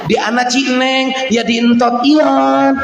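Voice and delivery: "entot ion" lisped for stasiun; lisping baby talk. Retold or imitated. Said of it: "Nyebut stasiun gak apa entot ion". (bicara cadel manja)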